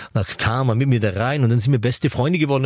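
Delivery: ordinary delivery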